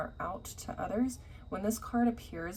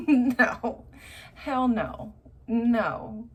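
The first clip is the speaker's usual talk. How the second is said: loud laughing no; laughing refusal; 3 semitones higher, 8 dB louder, much slower than usual. Real, not acted. Tarot reader laughing off an ex's apology, loud refusal. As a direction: laugh and shut it down hard.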